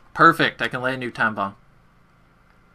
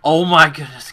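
excited; 4 semitones higher, 8 dB louder than usual. Real, not acted. Box spin, hoping for wonder weapon.

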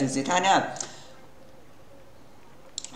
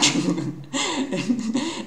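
high, breathy burst of laughter at his own song; 7 semitones higher, 5 dB quieter than usual. Real, not acted. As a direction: crack up laughing at the silly lyric. (bật cười thành tiếng)

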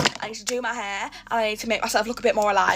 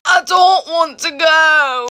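slow, louder whine; whiny dread; 4 semitones higher, 5 dB louder, slower than usual. Real, not acted. Girl whines to viewers that she won't go to school.

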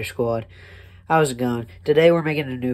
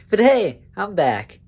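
excited; 6 semitones higher than usual. Real, not acted. Excited greeting back from break.

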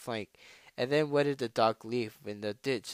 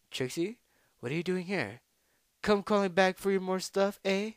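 tentatively pitched-up taunting challenger; botched rival voice; 7 semitones higher than usual. Acted. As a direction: try out a higher voice for the rival. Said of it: "That was a horrible voice".

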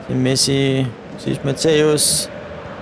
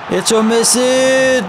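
drawn-out shout, higher and slow; frustrated shout; 7 semitones higher, 3 dB louder, slower than usual. Real, not acted. Gamer shouting at Eto'o's miss, drawn out. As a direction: yell it at the missed chance, slowly. Reacting to a missed chance.

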